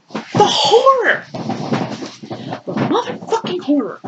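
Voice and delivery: drawn-out, high-pitched melodramatic horror; overdone theatrical horror; mock horror. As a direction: gasp it out slowly in fake dismay. Sarcastic reaction to the text.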